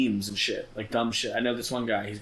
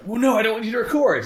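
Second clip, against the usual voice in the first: much higher, louder whiny refusal; mock whine; 11 semitones higher, 8 dB louder than usual. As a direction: squeal the no like a panicking caller. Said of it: "he's like".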